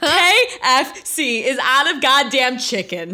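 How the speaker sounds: drawn-out retold indignation; indignant outburst. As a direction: hammer it out as an appalled customer.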